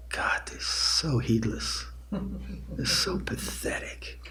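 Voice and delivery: whispered exasperated complaint, slow and drawn out; annoyed whisper. Acted. Annoyed meditator to themselves about a noisy latecomer, exasperated whisper. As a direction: whisper it slowly, like a private judgmental thought.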